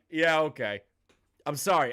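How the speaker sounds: usual speaking voice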